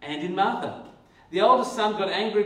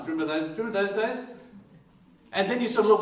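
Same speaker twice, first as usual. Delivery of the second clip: ordinary delivery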